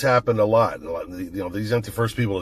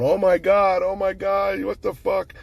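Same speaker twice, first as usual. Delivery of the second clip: higher, panicked retold cry from the wounded wife; mock panic; 11 semitones higher than usual. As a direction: imitate her shock on the ground, pitched way up. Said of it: "She's sitting there going".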